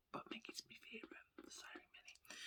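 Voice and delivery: barely audible playful whisper; confiding whisper. Pretend. Fan confessing favourite behind Minnie's back, whispered. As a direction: whisper it like a guilty secret.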